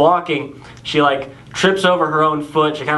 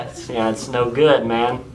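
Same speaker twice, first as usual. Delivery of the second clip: normal talking voice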